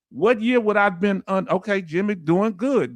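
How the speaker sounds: normal talking voice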